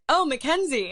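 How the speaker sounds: high, loud delighted recognition; mock surprised greeting. Fan imitates a star bumping into her backstage, surprised greeting. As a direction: greet someone as if suddenly recognizing them.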